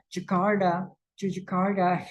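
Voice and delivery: mock a foreigner's Jakarta; wrong anglicized Jakarta. Shown as a demonstration. Teacher mocks the wrong English-style Jakarta. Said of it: "Tidak seperti itu".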